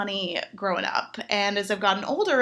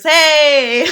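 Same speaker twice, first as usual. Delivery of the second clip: much louder, higher whoop; cheer; 4 semitones higher, 13 dB louder than usual. Real, not acted. To the applauding crowd, a loud whoop.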